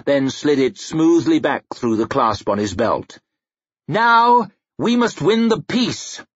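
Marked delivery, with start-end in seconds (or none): 3.9-6.2 high, slow, emphatic declaration; rally the lords solemnly; ringing royal oratory; rallying proclamation. A narrator's reading. King rallying the chamber.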